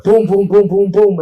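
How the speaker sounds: loud imitated pounding boom boom; banging noise. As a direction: pound out each boom like a fist on the door.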